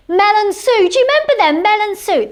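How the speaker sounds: high and loud, gushing; giddy fan squeal; excited. Real, not acted. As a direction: shriek the names like a superfan. Gushing about presenters.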